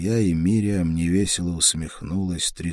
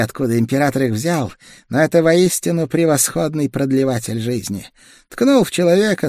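higher, tense voice of a sick woman; feverish young woman; 10 semitones higher, 5 dB louder than usual. A narrator's reading. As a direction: feverish woman, pushing through chills. Feverish woman magister speaks.

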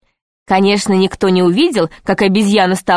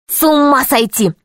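put-on higher-pitched, understated boyish amazement; mild amazed gasp; 5 semitones higher than usual. A narrator's reading. Pashka entering the room full of equipment, mildly amazed. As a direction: react with a touch of awe. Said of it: "ахнул Пашка".

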